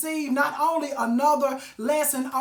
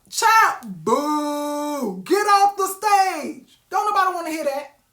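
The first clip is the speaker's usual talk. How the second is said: loud jeering boo, slow on the last words; mock heckling; 4 dB louder, slower than usual. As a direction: boo them off the stage. Heckling a quitter off stage.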